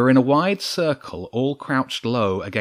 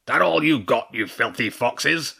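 roaring badger; 3 semitones higher than usual. A narrator's reading. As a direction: taunt the foxes like a brawler. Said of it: "the king roared".

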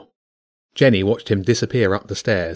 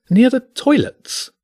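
much higher, slow, disdainful repeat of "Near the toilets?"; the maitre d' can't believe the request; incredulous echo; 4 dB louder, slower than usual. Acted. Maitre d' echoes in disbelief.

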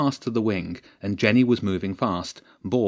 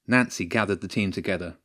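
ordinary delivery, nothing marked

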